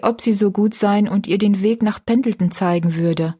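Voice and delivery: usual speaking voice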